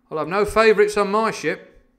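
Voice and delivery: high, loud captain's declaration; quite loud. A narrator's reading. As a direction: announce it loudly for all to hear. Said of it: "say quite loudly to the doctor".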